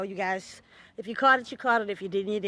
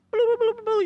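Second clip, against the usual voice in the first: mock alarm; 13 semitones higher, 8 dB louder than usual.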